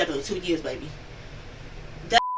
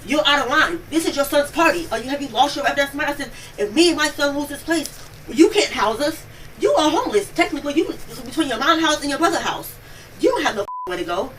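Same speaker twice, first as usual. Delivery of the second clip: loud, high, heated scolding; raised-voice rant at a drunk ex; retold angry telling-off; 7 semitones higher, 4 dB louder than usual. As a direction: tear into him like you did that night. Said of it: "this is literally how I'm talking to him".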